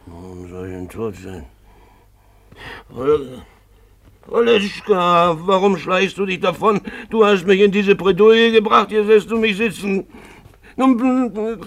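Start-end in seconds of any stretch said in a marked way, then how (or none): 2.9-11.6 put-on high, loud drunken yell; shouting after her. Drunk man yelling after Olga as she slips away, loud and high. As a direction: holler after the woman sneaking off.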